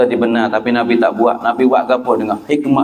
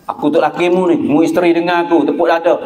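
higher-pitched, self-important husband; domineering husband; 6 semitones higher than usual. Acted. Husband telling his wife who is boss, domineering. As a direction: assert authority in a raised pitch.

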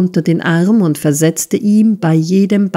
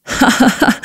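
high, gleeful stage laugh; gloating laugh; 7 semitones higher than usual. A narrator's reading. Misses Squeers cheers on the beating, gloating laugh. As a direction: laugh in triumph, high-pitched. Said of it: "frohlockte Misses Squeers".

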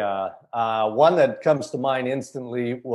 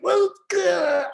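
strained squeak, pitched far up; reporter's voice cracking on live TV; throat closing. Retold or imitated. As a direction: force it through a locked throat. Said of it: "I can't clear my throat".

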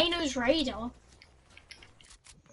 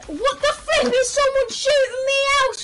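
high, loud outraged yell; real gamer rage, voice pitched way up; raging; 10 semitones higher, 7 dB louder than usual. Real, not acted. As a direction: scream it in exasperation. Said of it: "Would you stop raging".

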